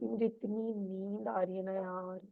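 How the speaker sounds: slow, soft hypnotic coaching voice; slow soothing guide. Shown as a demonstration. Coach guiding a sleepless student, slow and soft. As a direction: lull the listener toward sleep, slowly. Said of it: "अपने आप को ये बात कम से कम दस बार बोलो".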